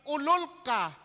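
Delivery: mock insult. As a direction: spit the insult out. Brother insulting brother.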